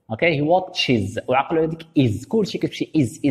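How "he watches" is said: He watches with the ending said like is; correct pronunciation. Shown as a demonstration. Teacher models the right ending for the class. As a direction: end watches on a clear iz sound. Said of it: "is، is في النطق".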